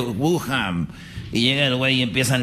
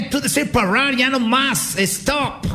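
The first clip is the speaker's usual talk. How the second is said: high, emphatic pidgin instructions; simplified foreigner-talk; 9 semitones higher, slower than usual. Acted. Host explaining to the Chinese contestant, high simplified foreigner-talk. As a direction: spell it out in broken phrases, pitched up.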